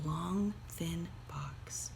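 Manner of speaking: hushed whisper on the last words. A narrator's reading. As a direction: drop to a breathy whisper for suspense. Reading suspense moment.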